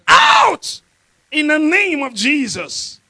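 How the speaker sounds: huge shout of out, then slow and loud; commanding shout. Retold or imitated. Preacher reenacting his command to the demon, roaring. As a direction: cast it out with full force.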